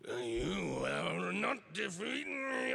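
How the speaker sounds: slow, shouted monster line; dragon voice. Retold or imitated. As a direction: roar it out slowly like a dragon. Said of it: "I did a line".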